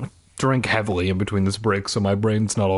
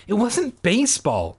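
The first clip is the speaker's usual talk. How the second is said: high protest, lightly choked; 8 semitones higher than usual. A narrator's reading. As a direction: force out a mildly strained protest. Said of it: "I choked out".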